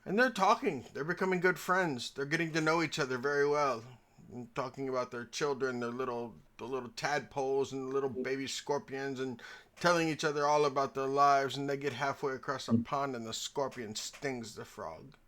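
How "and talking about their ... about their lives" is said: ordinary talk, nothing marked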